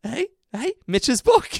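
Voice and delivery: put-on high, loud baffled old nan; nan's baffled surprise. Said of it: "her nan's got this face like".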